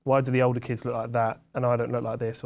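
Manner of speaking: ordinary delivery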